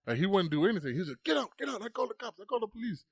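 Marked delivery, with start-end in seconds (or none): 1.2-3.0 high-pitched mock ordering; agitated store owner. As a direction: imitate a panicky shopkeeper threatening, voice pitched way up. Owner throwing kids out.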